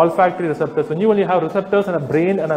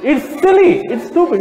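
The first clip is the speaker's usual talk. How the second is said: high, louder "It's silly" with a scoff; exclaimed; 9 semitones higher, 4 dB louder than usual. Real, not acted. Speaker dismissing the plants excuse, exclaimed high and loud. Repeated with different force.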